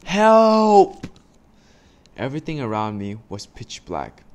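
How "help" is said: high, very loud cry for help; shout for help. Acted. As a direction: scream it as you fall. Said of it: "I shouted as loud as I could".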